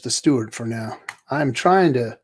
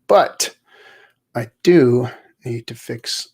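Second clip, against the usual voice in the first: normal talking voice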